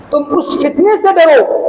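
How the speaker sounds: normal talking voice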